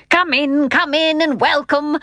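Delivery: high, cheery welcome; cheery calling. A narrator's reading. Unseen host calling from inside, cheery. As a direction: call out a hearty invitation. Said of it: "called a loud, cheery voice".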